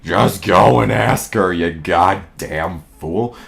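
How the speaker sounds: very low, soft, slow donkey; Eeyore. A narrator's reading. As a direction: grumble it deep and quiet, dragging the end. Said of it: "said Eeyore".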